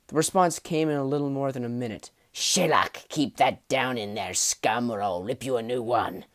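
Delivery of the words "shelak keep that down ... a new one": rough, gritty goblin growl. A narrator's reading. Goblin guard threatening a screaming prisoner, gritty voice. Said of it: "spoke in a low, gritty voice".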